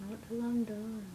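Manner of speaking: hushed whisper, no voice. Real, not acted. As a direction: whisper it under the breath. Friends bickering, then whispered repeat.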